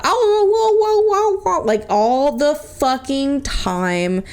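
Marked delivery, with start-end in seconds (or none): 0.0-1.6 high, howling woo sounds like a husky; playful husky howl imitation; imitating a howl. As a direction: howl it like the dog did. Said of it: "He loved the ow".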